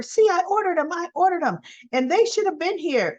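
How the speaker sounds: high-pitched mock fluster; imitated frantic insistence. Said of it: "her being frantic".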